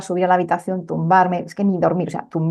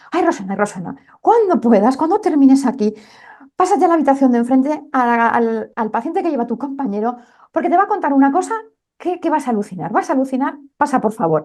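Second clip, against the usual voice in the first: higher, fast, urgent pleading of a scared colleague; frightened nurse; 6 semitones higher than usual. Retold or imitated. Scared nurse urging the doctor to see the patient, fast and high. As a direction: rush it out like a nurse who just got spooked. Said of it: "Estaba francamente asustada".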